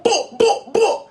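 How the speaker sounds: high pitched boop boop boop; high boop sounds. Pretend. Showing off his Jamaican outfit, high loud boops.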